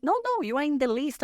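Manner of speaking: high, fast excited correction; excited insistence. Retold or imitated. Friends assuring her she made the list, imitated excited insistence.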